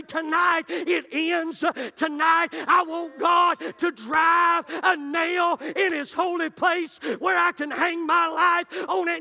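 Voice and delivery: a preacher gives the congregation words to shout, slow and loud; defiant declaration. Pretend. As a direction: declare it like a battle cry. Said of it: "get an attitude and say".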